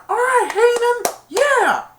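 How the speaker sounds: high, loud cheer. Retold or imitated. Junior cheering Heenan from the stands, high-pitched whoop. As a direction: leap up and whoop. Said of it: "Junior stood up and went".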